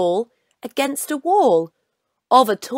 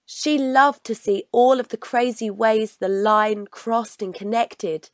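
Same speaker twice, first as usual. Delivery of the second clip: ordinary delivery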